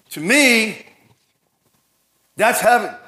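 ordinary talk, nothing marked

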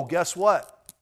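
ordinary talk

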